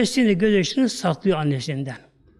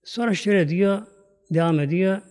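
ordinary talk, nothing marked

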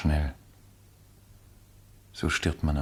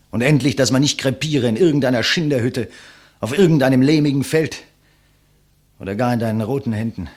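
louder and higher, swelling with conviction; impassioned rising speech; 6 semitones higher, 9 dB louder than usual. Acted. Condemned man defying his executioner, rising to a loud impassioned peak. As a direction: hurl it at the executioner.

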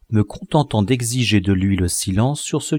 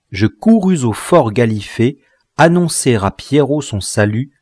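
plain reading voice

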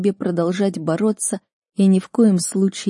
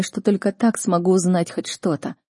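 usual speaking voice

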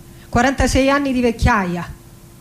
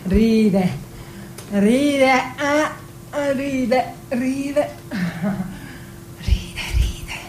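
fading; 6 dB quieter, much slower than usual. Acted. Repeating ride, fading out.

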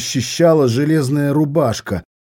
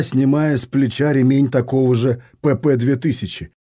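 ordinary delivery, nothing marked

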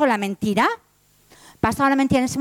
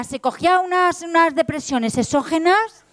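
usual speaking voice

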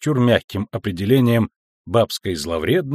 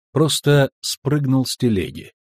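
plain reading voice

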